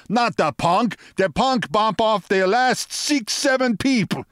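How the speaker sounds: very high, excitable henchman; high-voiced protest without real volume; mock shouting. A narrator's reading. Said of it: "he shouted".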